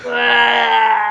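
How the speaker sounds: slow-motion falling cry; falling yell. Acted. Brendan Fraser falling off the cliff, yelling.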